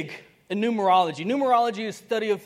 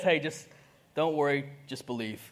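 soft Jesus voice; 7 semitones lower, 5 dB quieter than usual. Acted. Jesus answering the doubter about bills, soft and low. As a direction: lower and fading softer, unbothered.

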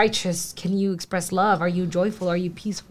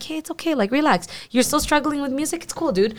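gentle reassurance; 6 semitones higher than usual. Retold or imitated. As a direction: soothe a struggling friend, lightly. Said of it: "other people who are just like".

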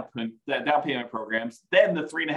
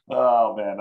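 ordinary talk

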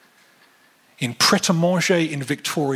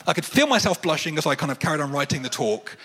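ordinary delivery

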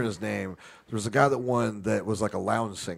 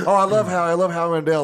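higher, louder gushing; fanboying; 6 semitones higher, 6 dB louder, faster than usual. Retold or imitated. Southern singer gushing over Howie on live TV, fanboying. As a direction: gush like a starstruck fan. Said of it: "Fanboying a little bit".

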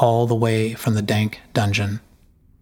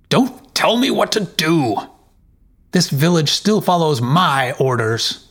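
higher pitched angry retort; furious outburst; 6 semitones higher than usual. Acted. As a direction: Jarl rounds on his meddling wife in fury. Jarl rages at wife.

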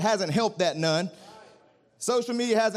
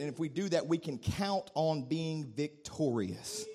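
much softer, lower intimate prayer; quiet prayer; 4 semitones lower, 6 dB quieter than usual. Acted. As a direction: pray it close and hushed.

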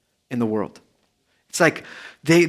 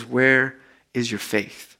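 calm rebuke; 4 semitones lower, 4 dB quieter, slower than usual. Retold or imitated. Jesus calmly rebukes the disciples.

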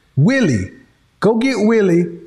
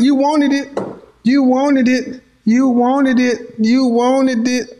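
high, slow taunting chant; slow teasing taunt; 6 semitones higher, slower than usual. Pretend. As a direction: drag it out to rub it in. Teasing repeat at Melanie.

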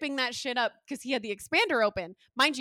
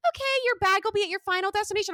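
high-pitched mock agent voice; airline staff; 8 semitones higher, 4 dB louder than usual. Airline agent promising a bag will arrive, mock reassuring. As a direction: imitate a breezy agent reassuring a passenger.

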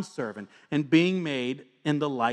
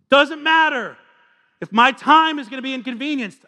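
louder, higher preacher voice, building; fiery sermon delivery; full-force preaching; 8 semitones higher, 7 dB louder than usual. Acted. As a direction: preach it out over the room.

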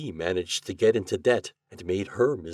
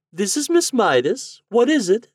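very high, loud lady's voice; sweetly lilting high phone greeting; 9 dB louder than usual. A narrator's reading. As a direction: put on a high, sweet ladylike voice. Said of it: "a sweet voice said".

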